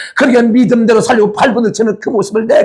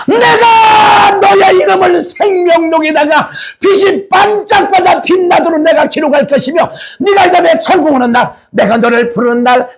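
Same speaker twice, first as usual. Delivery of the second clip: high ringing proclaiming voice of the Lord, shouted; exultant high shouting heavenly welcome; Jesus welcoming; 6 semitones higher than usual. Acted. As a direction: cry out joyfully as Jesus welcoming his bride. Preacher voices Jesus welcoming bride.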